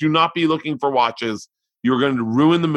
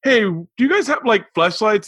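much higher, sheepish question; awkward shy ask; 8 semitones higher, 3 dB louder than usual. Retold or imitated. Customer asking a store clerk, awkward and high. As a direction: ask like you're embarrassed to be there. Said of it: "going in and going".

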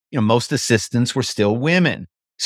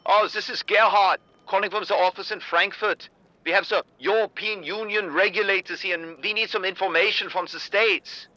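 put-on higher, softer German-accented executive voice; Gerhard's German accent; 8 semitones higher, 6 dB quieter than usual. Caller posing as Frankfurt compliance chief, soft higher German accent. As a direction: purr it in a smooth German accent, pitched up. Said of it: "I would put on an accent".

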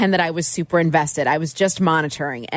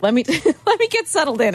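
normal talking voice

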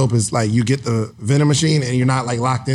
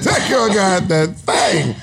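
ordinary talk

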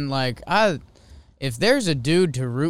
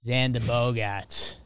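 slow Boston accent, r dropped; drawn-out Boston accent on the name; slower than usual. Shown as a demonstration. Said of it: "give me Xander's name in the Boston accent".

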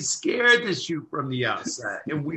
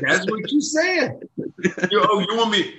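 laughing protest; 8 semitones higher, 5 dB louder than usual. Real, not acted. Teasing back and forth, laughing.

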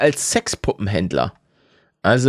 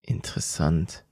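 whispering; 10 semitones lower, 10 dB quieter than usual. Real, not acted. Soft aside after long pause.

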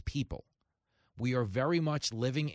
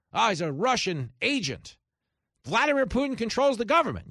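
higher, louder mock alarm over a Russian agent; mocking quote; 8 semitones higher, 5 dB louder than usual. Commentator mocking critics of Trump, in hammed-up alarm. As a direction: ham up the panicked accuser.

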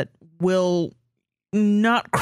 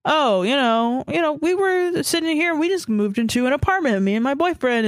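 higher-pitched, lightly hammed-up needling; passive-aggressive; 6 semitones higher than usual. Grown child hinting at dad over dinner, mock passive-aggressive. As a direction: drop hints with mock-casual innocence. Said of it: "the passive-aggressive boomer that is like".

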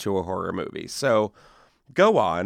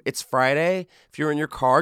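usual speaking voice

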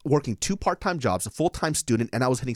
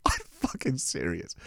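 slow, whispered insistence; whispery and incredulous; hushed disbelief; 6 dB quieter, slower than usual. Real, not acted. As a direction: drop to a drawn-out whisper to swear it's true. Hushed insistence after listener's disbelief.

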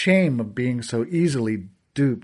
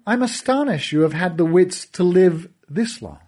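higher, sneering villain voice; gloating villain; 3 semitones higher than usual. Acted. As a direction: toy with the boy, mock him.

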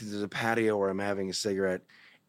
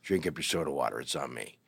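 low, gruff-sounding big bartender voice, softer; tough bartender; 7 semitones lower, 4 dB quieter than usual. Retold or imitated. Huge bartender warning off the straight boy, low and soft. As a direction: lower your voice into a huge leather bar bouncer. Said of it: "he's like".

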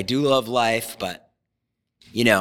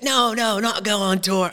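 put-on playful English accent, pitched up; imitating a friend in an English accent; 8 semitones higher than usual. Imitating Harry's advice in an English accent. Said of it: "I'm doing an English accent".

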